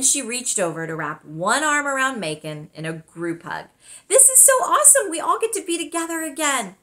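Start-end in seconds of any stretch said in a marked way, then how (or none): 4.1-6.8 put-on high, fast, gushing girl's voice; bubbly girl thrilled to be reunited; gushing excited girl. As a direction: squeal it like a thrilled kid. Girl gushes at reunion hug.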